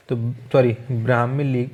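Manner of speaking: ordinary talk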